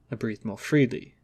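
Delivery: usual speaking voice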